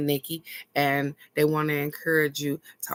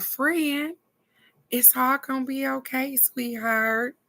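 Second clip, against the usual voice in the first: soothing friend; 8 semitones higher, slower than usual. Retold or imitated. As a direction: coo it gently like a kind friend. Voicing Razia comforting her.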